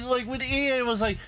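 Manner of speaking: normal talking voice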